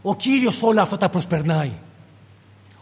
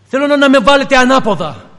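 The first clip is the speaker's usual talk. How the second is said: loud, raised insistence; emphatic demand; 6 semitones higher, 8 dB louder than usual. Retold or imitated. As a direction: Peter insisting to his executioners, voice lifted high. Voices Peter demanding upside-down crucifixion.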